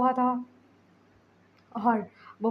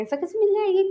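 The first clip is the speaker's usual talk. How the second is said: much higher, loud indignant protest; 10 semitones higher, 6 dB louder than usual. Acted. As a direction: demand it in outrage. Angry Wing protests. (ऊँची आवाज़ में गुस्सा)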